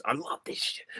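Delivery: hushed, building-up enthusiasm; hushed passion. Acted. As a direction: say it under the breath with zeal. Acting out passion, starts low.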